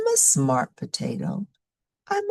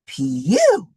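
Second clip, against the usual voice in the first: a quick, louder zapping pew; playful pew sound effect; 5 dB louder than usual. Acted. Narrator makes the power cut with a louder pew. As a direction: pop the lights out with a cartoon pew.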